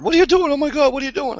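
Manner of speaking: very high, put-on shriek; mock horrified girlfriend. Man mimicking his girlfriend catching him skinning squirrels, horrified squeal. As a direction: imitate an appalled girlfriend way up high.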